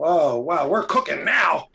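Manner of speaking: loud, slow mock-excited cheer; mock hype. Host hyping three viewers, mock hype. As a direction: whoop it up, drawing out the words. Hyping a tiny audience count.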